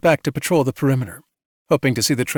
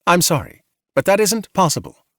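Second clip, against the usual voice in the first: polite automated voice, a bit higher; robot voice; 6 semitones higher than usual. A narrator's reading. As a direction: sound like a calm machine. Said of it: "replied the robotic voice".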